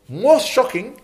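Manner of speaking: high, loud outburst of disbelief; shocked exclamation. Real, not acted. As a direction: cry out in shock. Preacher exclaiming indignantly.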